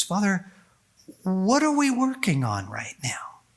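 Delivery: whispered, intimate question to the Father; hushed, earnest whisper to God; whispered prayer. Retold or imitated. Quoted simple prayer to Father.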